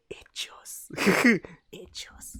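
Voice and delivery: breathy playful whisper. Real, not acted. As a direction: whisper it with a giggle.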